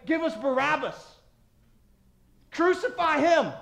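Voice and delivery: preacher playing the angry crowd; mock crowd yell. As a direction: shout it like the mob. Voicing the crowd's demand.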